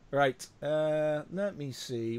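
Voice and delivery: usual speaking voice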